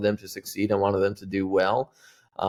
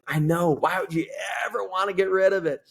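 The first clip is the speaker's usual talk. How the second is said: put-on high-pitched old lady protest; 9 semitones higher than usual. Elderly lady protests piano sale.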